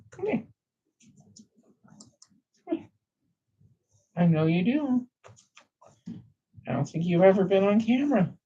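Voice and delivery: high, sing-song pet talk; cooing to a pet. Real, not acted. Cooing high to his cat Stormy in front of the camera.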